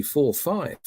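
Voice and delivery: ordinary talk, nothing marked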